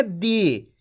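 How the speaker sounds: दी with the ई held long and drawn out; stretched long ii. Shown as a demonstration. Teacher shows students the long ii, stretched. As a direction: stretch the vowel of दी as a demo. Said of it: "खींच के करेंगे।".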